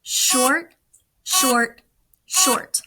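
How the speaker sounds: each short said crisp and quick; clipped short beeps. Shown as a demonstration. Teacher to students, sounding out the letter C, crisp and quick. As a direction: tap out short short short like dots. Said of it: "three short sounds".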